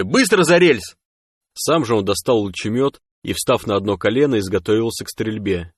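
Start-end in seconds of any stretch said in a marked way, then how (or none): 0.0-1.0 high, loud urgent command; barked order. A narrator's reading. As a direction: shout them behind cover at once. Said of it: "рявкнул он Фиру с подругой". (громкий резкий приказ)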